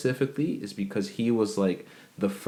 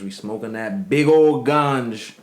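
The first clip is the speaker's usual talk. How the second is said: put-on playful Jamaican accent, a bit louder; 3 semitones higher, 7 dB louder than usual. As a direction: lay on a Jamaican patois, joking about smoking. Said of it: "Forgive for my brother's accent".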